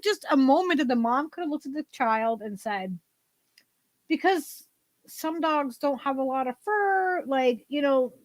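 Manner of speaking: ordinary delivery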